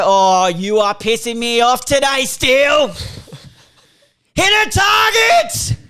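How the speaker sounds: slow build to a high, drawn-out yell; exasperated fan shout. Acted. Showing what a fan can yell at Steele, exasperated shout. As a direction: bellow at a player from the stands. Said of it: "Like, you can go".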